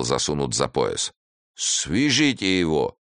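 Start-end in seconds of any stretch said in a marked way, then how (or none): 1.5-3.0 slow, higher barked order; a leader's curt command. A narrator's reading. One-armed leader orders his men, commanding. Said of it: "приказал Однорукий".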